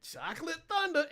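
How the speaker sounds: high, laughing cry of admiration; gleeful swooning. Pretend. Host reminiscing about a favorite dancer, laughing swoon. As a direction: swoon over the name, breaking into laughter.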